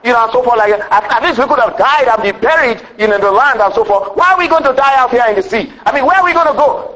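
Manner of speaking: high-pitched imitation of a panicked protest; mock grumbling complaint. Preacher voicing Israelites trapped at the Red Sea, mock complaining. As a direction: complain like a fed-up crowd, hammed up from the pulpit. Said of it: "they began to grumble and complain".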